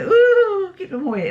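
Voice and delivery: high squeal of "Ooh" imitating the family; a squeamish shriek, then backing off; imitating the family's squeamish squeal; mock squeamish. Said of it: "And they".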